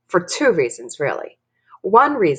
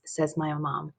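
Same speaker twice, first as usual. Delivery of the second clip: plain reading voice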